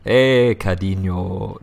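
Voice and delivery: sleazy, clumsy attempt at a Spanish accent; lightly faked Spanish accent, slowing down; bad Spanish accent. Shown as a demonstration. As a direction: try a creepy mock Spanish accent. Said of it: "if I try and do a Spanish accent".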